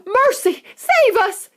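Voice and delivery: very high, loud yell; yelling mother. Acted. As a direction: cry out in terror. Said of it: "yelled Missus Beauregard".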